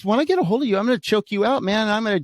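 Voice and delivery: high-pitched imitated threat; retold threat. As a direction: mimic a worked-up rival making threats. Acting the rival's threats.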